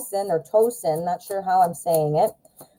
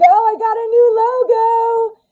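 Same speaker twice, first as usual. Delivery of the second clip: excited; 3 dB louder than usual. Real, not acted. Showing off her new logo.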